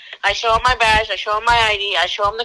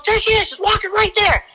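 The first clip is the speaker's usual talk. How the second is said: imitating high-pitched, urgent excitement; high re-enacted alert to a companion; excited pointing out; 12 semitones higher than usual. Partner pointing out the girl to the driver, excited.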